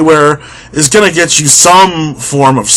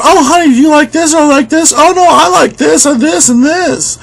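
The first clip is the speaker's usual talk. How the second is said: much higher, sing-song voice of a fussy couple debating groceries; lovey-dovey shopper voice, much higher and fussy; mock couple chatter; 10 semitones higher than usual. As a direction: play a dithering partner, pitched way up and a bit quick. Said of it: "And they're going".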